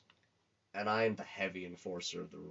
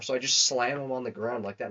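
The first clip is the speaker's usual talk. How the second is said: usual speaking voice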